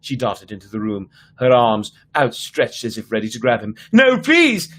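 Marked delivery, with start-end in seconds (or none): 3.9-4.7 high, loud terrified plea; a terrified boy begs the masked girl, high frightened plea; terrified cry. A narrator's reading. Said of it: "he cried".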